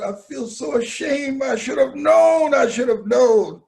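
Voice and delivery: high, loud cry of regret; loud self-blame. Retold or imitated. Mama crying out her shame to her son. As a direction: belt out the shame.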